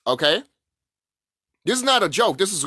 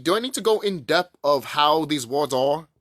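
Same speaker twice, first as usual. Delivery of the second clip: usual speaking voice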